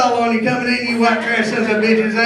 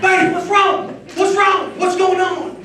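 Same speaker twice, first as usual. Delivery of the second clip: mock panic; 10 semitones higher than usual. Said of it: "rushing upstairs worried".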